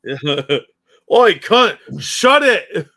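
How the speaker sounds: slow, high, very loud Aussie bellow; mock Aussie shout. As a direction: yell it like a hammed-up angry Aussie. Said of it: "Aussie would have called them".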